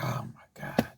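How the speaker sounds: breathless whisper of hunger; whispered longing. Retold or imitated. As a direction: whisper it to yourself, starving. Longing on top bunk.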